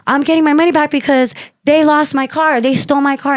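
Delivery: high-pitched indignant announcement; aggrieved public complaint, retold; announcing to a crowd. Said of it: "I'm telling all the people".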